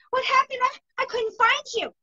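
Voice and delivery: high-pitched imitation of a distraught daughter; retold freaking out. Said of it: "like, freaking out".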